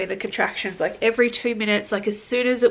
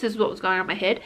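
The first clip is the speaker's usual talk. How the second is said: normal talking voice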